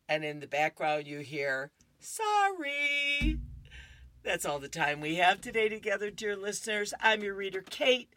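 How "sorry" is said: offstage sorry. Acted. Offstage apology in comic.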